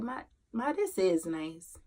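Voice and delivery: very soft, hushed and low; retold tender child. Son lying down beside his depressed mom, tender and quiet. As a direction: quote the child gently, barely raising the voice.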